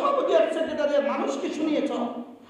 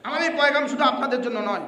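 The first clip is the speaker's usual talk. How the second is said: ordinary talk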